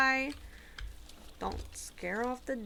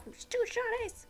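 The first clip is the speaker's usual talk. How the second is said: breathless, thrilled whisper over shinies; excited whisper; 13 semitones higher than usual. Real, not acted. Streamer spotting two shinies, thrilled whispering. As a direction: hiss the find out in a rush.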